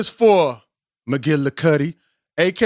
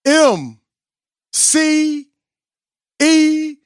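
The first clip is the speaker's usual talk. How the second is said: high, drawn-out hype call of the name; hype introduction; 8 semitones higher, much slower than usual. Acted. Host introducing the guest group, high slow hype call. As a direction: stretch the name out like a ring announcer.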